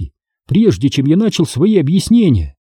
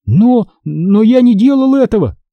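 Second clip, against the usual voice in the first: high, halting denial; stammering protest; 8 semitones higher, 3 dB louder than usual. A narrator's reading. Boy denying it to father, stammering. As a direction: stumble into the protest, pitched up. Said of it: "заикаясь, промямлил я".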